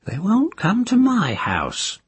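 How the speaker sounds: put-on high, cracked old crone gossiping; nosy old village witch. A narrator's reading. Moss speaks.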